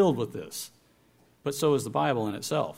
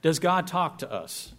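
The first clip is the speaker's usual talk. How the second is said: ordinary talk, nothing marked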